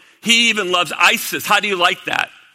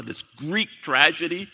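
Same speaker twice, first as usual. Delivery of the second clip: ordinary delivery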